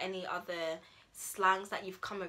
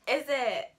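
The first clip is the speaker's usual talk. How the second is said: surprised; 6 semitones higher, 8 dB louder than usual. Acted. Acting out reaction to friend's news.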